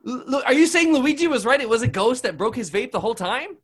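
loud, high-pitched disbelief; astonished. Acted. Host learning a ghost broke the vape, astonished. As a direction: blurt it out, stunned by the reveal.

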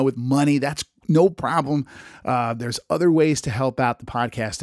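ordinary delivery

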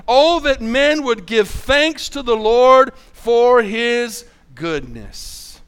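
loud then fading, very slow psalm reading; slow scripture reading. Acted. Pastor reading the psalm to the church, slow and emphatic. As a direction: stretch each word of the verse.

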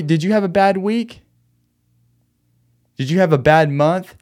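usual speaking voice

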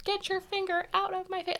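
high, soft mocking quote of a mom yelling; mock fight quote. Mocking the mom's line from the reunion fight, high and soft. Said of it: "as funny as it is".

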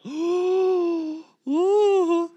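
high, drawn-out "ooh"; mock collective shock in a high voice; shocked congregation gasp. Congregation watching a Bible thrown at the preacher, mock shocked oohs. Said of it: "everyone else in church would be like".

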